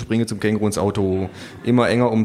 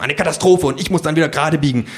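fussy agent; 6 semitones higher, 4 dB louder, faster than usual. Acted. Agent voiced, different timbre.

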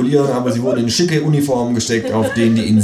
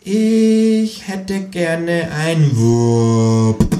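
higher, slow and deadpan kangaroo; 9 semitones higher, 4 dB louder, much slower than usual. Acted. Kangaroo ordering at the counter, slow and higher. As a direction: order it drawn out like a stubborn kangaroo.

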